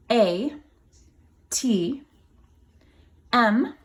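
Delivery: put-on slow letter-by-letter spelling for children. Shown as a demonstration. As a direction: spell the title to the kids.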